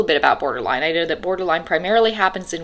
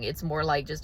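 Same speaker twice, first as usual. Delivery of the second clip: ordinary talk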